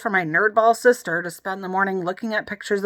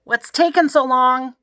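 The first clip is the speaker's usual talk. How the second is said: yelling through door; 5 semitones higher, 5 dB louder, slower than usual. A narrator's reading. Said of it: "Emily yelled".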